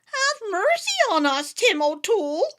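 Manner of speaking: high wee-folk voice begging, smile hidden; begging little man. A narrator's reading. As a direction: squeak out a mock plea for mercy. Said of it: "begged the leader of the little people".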